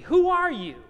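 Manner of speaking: high, loud challenge; insistent question. Acted. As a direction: demand an answer, pitched well up. Questioners repeat their challenge.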